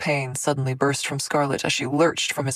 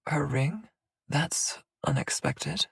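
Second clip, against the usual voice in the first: dry, slow, hushed quip; 4 dB quieter, slower than usual. A narrator's reading. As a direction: toss it off with a slow, breathy aside. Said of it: "Nuri quipped".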